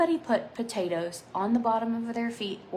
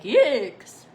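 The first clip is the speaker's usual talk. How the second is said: yikes; 4 semitones higher, 13 dB louder than usual. Retold or imitated. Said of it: "then they're like".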